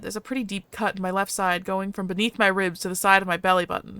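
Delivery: ordinary talk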